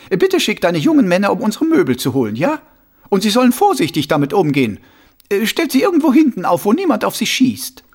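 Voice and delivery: high, loud, brisk character voice; Frau Rudolf giving orders. Acted. Frau Rudolf sends for her furniture, high and insistent. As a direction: rattle off instructions, pitched well up.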